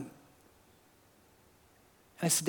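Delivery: normal talking voice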